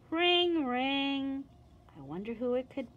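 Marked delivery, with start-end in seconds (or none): 0.1-1.4 doorbell ring. Acted. Imitating the doorbell.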